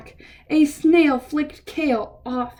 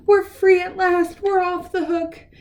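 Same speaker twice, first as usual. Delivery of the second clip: louder and higher, overjoyed; joyful celebration; 7 semitones higher, 3 dB louder than usual. Acted. Reading to kids, the waiter and cook celebrating their freedom. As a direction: celebrate being free.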